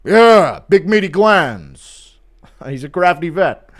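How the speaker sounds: loud, drawn-out mock hype voice; hammed-up, slow, loud coach impersonation; Lucas. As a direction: stretch it out like an admiring coach. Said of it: "Lucas catching McGregor".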